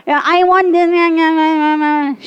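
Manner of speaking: put-on drawn-out kid's whine; whining child. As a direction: imitate a child nagging and wailing. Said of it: "The little one is crying. The elder one is like".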